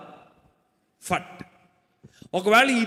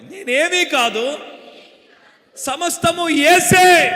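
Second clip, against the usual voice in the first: slow, rising to a high loud shout on యేసే; shouted call-out; 9 semitones higher, 7 dB louder, much slower than usual. Shown as a demonstration. Preacher leads the church in a chant, building to a shout. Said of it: "నేను ఎలా చెప్పినా అలా చెప్పాలి".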